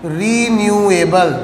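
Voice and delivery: renewable stretched slowly over each syllable; drawn-out term. Shown as a demonstration. Term dictated slowly, then repeated.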